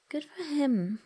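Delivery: amused laugh. Real, not acted. Reader laughs at own story.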